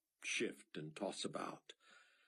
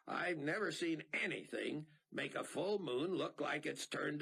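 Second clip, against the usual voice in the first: higher, agitated pilot; Mac's voice; 10 semitones higher than usual. A narrator's reading. Pilot pressing Rayford about the blood moon, agitated. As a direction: fire off puzzled questions.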